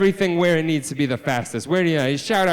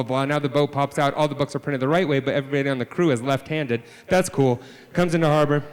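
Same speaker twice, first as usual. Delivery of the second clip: ordinary talk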